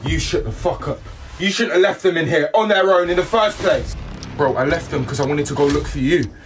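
normal talking voice

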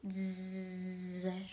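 long, steady "zzz" buzz; buzzing flies. A narrator's reading. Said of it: "ronzarono le mosche". (ronzio di mosche)

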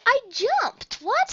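high, loud astonished cry; surprised shout. Real, not acted. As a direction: yelp in disbelief. Surprise after gasp.

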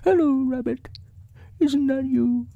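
very high, hopeful bear voice; Pooh. Acted. Bear greeting his friend down the hole, very high voice. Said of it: "put his head back in the hole and said".

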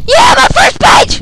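high, loud cheer; excited shout. Real, not acted. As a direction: celebrate the win. Finding first page.

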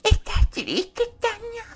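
high mocking baby voice, drawn out; mock whiny voice. Vlogger mocking Tania's tears, high cooing mock voice. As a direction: coo it like consoling a crybaby. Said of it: "O sea, en serio".

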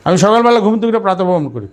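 same sentence run through briskly in one breath; fast, continuous tune, peaking early and dropping on করি. Shown as a demonstration. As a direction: rattle it off without pauses, falling at the end. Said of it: "কথা কিন্তু একই, সুর change".

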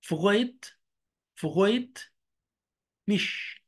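فغاية said with the R like Arabic غ, German freut; R as ghain. Shown as a demonstration. Teacher showing the standard German R in freut mich. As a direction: roll the R in فغاية back like غين. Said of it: "بينطق زي الغين".

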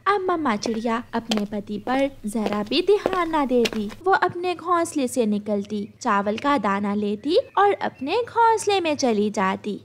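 ordinary delivery, nothing marked

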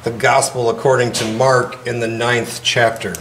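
steady formal gospel reading; scripture reading. Acted. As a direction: proclaim it to the congregation. Reads the gospel aloud.

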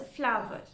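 "flower" in two beats, FLOW-uh, rhyming with "power"; correct pronunciation. Shown as a demonstration. Teacher shows learners how the first word should be pronounced, correct "flower". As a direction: say "flower" as FLAU-vuh, two syllables. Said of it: "should be pronounced as".

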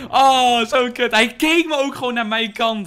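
high, loud gleeful rush; buzzing after the kill; excited. Real, not acted. Hyped after sniping kill.

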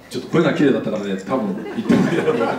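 chuckling through a joke about her voice; amused, laughing confession. Real, not acted. Joking about the caller's pretty voice.

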